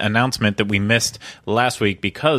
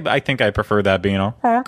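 ordinary talk

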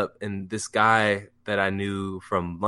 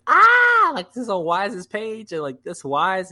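high squeal easing into breathless gushing; fanboy freak-out; 12 semitones higher, 3 dB louder, slower than usual. Retold or imitated. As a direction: squeal it, re-enacting a starstruck moment. Acts out freaking out.

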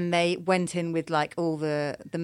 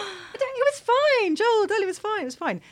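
reassuring husband. Retold or imitated. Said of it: "I was like".

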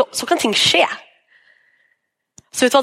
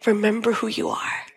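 soft voice of God; 6 dB quieter than usual. Retold or imitated. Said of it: "hvis han bare sier".

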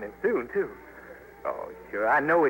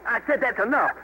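louder and higher, laying down the law; angry command; 8 semitones higher, 4 dB louder than usual. Acted. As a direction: bark it as a final warning. Repeats warning during scuffle.

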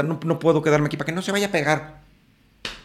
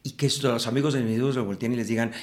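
usual speaking voice

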